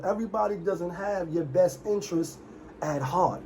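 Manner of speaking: ordinary talk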